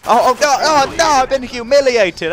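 high, loud and slow dramatic despair; anguished wail. Real, not acted. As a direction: wail it out as if your world has ended. Demoted in the game.

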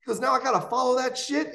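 high, quick, exasperated complaint; annoyed host. Retold or imitated. Rudy Rush complains to Bob about the running order, high and exasperated. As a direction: grumble at Bob, pitched well up.

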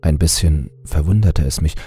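ordinary delivery, nothing marked

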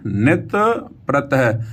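"ਨਿਤ" rising, clear pause, then "ਪ੍ਰਤ ਹੈ" falling; words kept separate. Shown as a demonstration. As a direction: break firmly between "ਨਿਤ" and "ਪ੍ਰਤ ਹੈ". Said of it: "ਨਿਤ ਵੱਖਰਾ ਪੜ੍ਹਨਾ ਹੈ".

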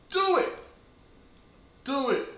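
high, loud urging call; excited urging. Real, not acted. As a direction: push them to just do it.

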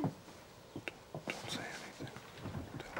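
urgent whisper. Acted. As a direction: hiss it under your breath, hiding. Hiding from intruder.

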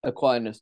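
"Aquinas" said ah-KWY-nus, with a clear "kw"; "Aquinas" with "kw" and a long "eye" vowel; correct pronunciation. Shown as a demonstration. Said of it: "how do you say Aquinas?".

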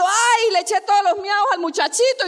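a hammed-up yell of the neighbour woman; retold shout. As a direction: call it out loud and high, as if telling the whole house. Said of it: "salió gritando".

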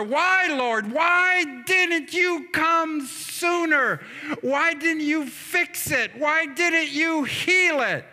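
high, drawn-out wail of protest; anguished pleading. Retold or imitated. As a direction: cry out to the Lord as grieving sisters. Voicing grieving Mary and Martha.

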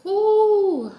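high, loud laughing whoo; spicy whoo. Real, not acted. After the spicy crawfish, loud laughing whoo. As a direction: let out a laughing whoo at the heat.

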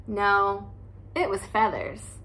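usual speaking voice

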